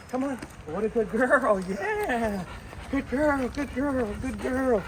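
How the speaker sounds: high, bubbly dog praise; excited praise. Real, not acted. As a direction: cheer the dog on in a sing-song.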